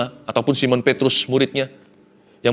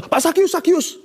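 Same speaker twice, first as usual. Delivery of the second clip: much higher, sugary mock sucking-up; butter him up, falsely sweet; fawning flattery. Flatterers insincerely greeting the rich tax collector, hammed-up fawning. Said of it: "Yang menjilat kepadanya".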